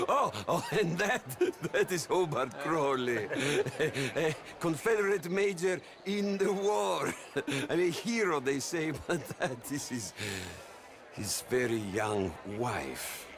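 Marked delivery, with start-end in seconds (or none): none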